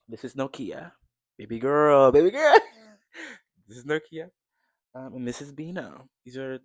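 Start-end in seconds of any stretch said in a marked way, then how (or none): 1.4-2.1 loud cooing call to the cat, higher; pet talk. Real, not acted. 2.1-4.3 much higher, loud squeal breaking into a laugh; excited pet talk. Real, not acted. Cooing at her pet cat.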